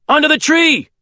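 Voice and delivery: very high, straining shout; urgent shout. A narrator's reading. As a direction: shout directions over the helicopter. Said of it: "I shout".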